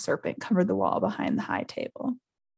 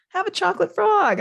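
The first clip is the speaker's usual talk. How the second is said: cheerful offer; 12 semitones higher, 5 dB louder than usual. Acted. Harry cheers Hagrid up.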